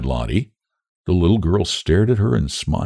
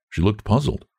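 ordinary delivery

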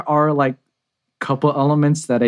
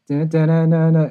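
mimicking the beat; 5 semitones higher than usual. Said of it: "in the chorus part".

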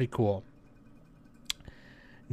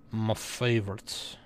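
slow, softer put-on repeat of FAVORITE; playful put-on voice; 6 dB quieter, slower than usual. Vlogger echoing their own favorite, playful put-on voice. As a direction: draw out FAVORITE softly in a mock voice.

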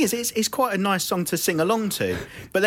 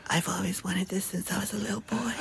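mock whisper; 2 semitones higher, 9 dB quieter than usual. Mocking [name]'s spoken bit, in a whisper. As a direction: whisper it like a cringey love song break. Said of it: "He goes".

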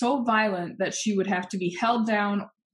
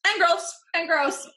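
mock high echo; 9 semitones higher than usual.

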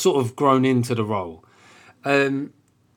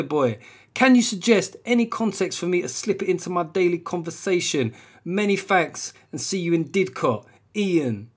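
higher cool urban South London voice throughout; South London accent; 5 semitones higher than usual. Retold or imitated. Comedian restarting Ian's review in a cool South London accent. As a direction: lay on the bruv accent for the whole letter. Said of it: "accent I was doing there".

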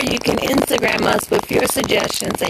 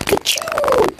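sneezing. Real, not acted. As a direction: sneeze sharply mid-sentence. Sneeze right after "All right.

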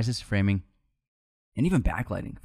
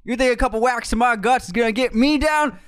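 a cocky Southern villain bragging, loud and high; Southern accent; 9 semitones higher, 5 dB louder than usual. Shown as a demonstration. Host does a Southern impression of Max Cady, loud and high. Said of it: "I'll try Southern for this".

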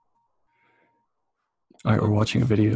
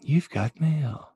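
slow, raised computer-announcement voice; mock mail alert; 5 semitones higher, much slower than usual. Host mimicking activated Clinton, slow mail-alert voice. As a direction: announce it slowly like an automated greeting, pitched up.

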